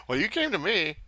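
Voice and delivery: normal talking voice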